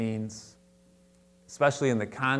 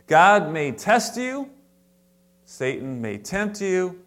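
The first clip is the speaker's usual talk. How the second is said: emphatic; 6 semitones higher, 4 dB louder, slower than usual. Real, not acted. Preacher building a warning.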